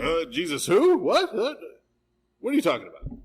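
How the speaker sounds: mock baffled denial, pitch jumping up on What; feigned confusion, high and innocent; a flustered man pretending not to know; playing dumb. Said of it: "So he was like".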